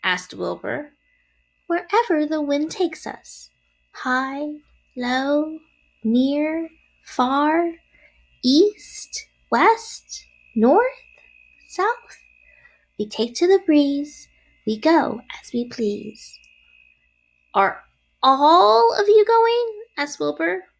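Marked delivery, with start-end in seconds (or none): 1.7-16.4 high little spider voice; word after word, lightly pitched up high; breezy baby spider. A narrator's reading. Departing spiderling answers Wilbur, carefree. 17.5-19.8 high, loud, drawn-out dismay; dismayed young pig. A narrator's reading. Wilbur asks the departing spiders, dismayed.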